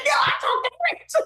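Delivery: shouting the news at her mother, insisting it's true; excited scream. Retold or imitated. Daughter yelling to doubting mama on the phone, excited shout. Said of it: "screaming and hollering".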